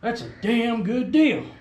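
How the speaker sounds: slow, drawn-out amazement; retold awe at a bargain, slow; slow amazed. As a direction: savour the deal slowly, impressed. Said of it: "I said".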